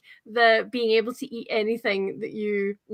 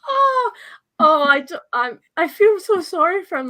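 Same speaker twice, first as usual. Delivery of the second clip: mock pity; 7 semitones higher, 7 dB louder than usual. Real, not acted. Pity for the dog.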